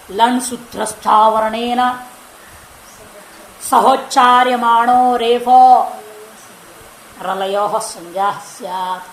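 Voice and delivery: slow measured chant of the Sanskrit rule; slow sutra recitation. Shown as a demonstration. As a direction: recite each word of the sutra slowly and evenly. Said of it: "आगे कह रहे हैं". (धीमा सूत्र-पाठ)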